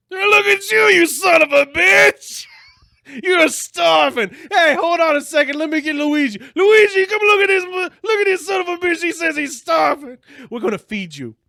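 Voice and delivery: excitable Italian restaurateur shouting, very high and loud; Giuseppe. Retold or imitated. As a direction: holler for a colleague in hammed-up amazement. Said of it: "Giuseppe says".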